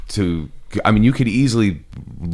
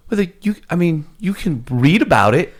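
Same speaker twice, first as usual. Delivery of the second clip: exasperated; 11 semitones higher, 5 dB louder than usual. Retold or imitated. Retelling her own exasperated reply.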